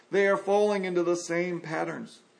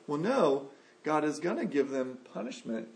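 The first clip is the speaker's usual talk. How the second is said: normal talking voice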